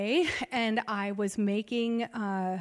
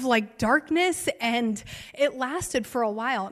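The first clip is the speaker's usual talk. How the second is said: usual speaking voice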